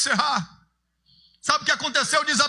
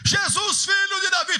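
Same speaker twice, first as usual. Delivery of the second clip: desperate cry; 8 semitones higher than usual. Acted. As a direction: call out desperately across the crowd.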